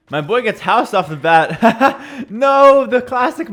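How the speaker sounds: real laugh spilling over the words. Real, not acted. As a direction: crack up as your unit gets wrecked. Streamer laughs at his unit dying.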